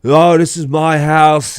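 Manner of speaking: imitated drunken insistence; drunk earnest protest. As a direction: wail it like an indignant drunk kid. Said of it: "like, so serious".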